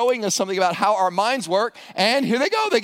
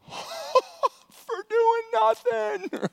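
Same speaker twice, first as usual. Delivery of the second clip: very high, very slow, giggling wonder; gleeful disbelief; much slower than usual. Acted. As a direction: stretch it out in giddy delight. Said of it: "walking back like".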